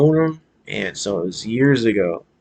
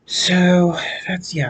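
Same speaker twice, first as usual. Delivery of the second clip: ordinary talk, nothing marked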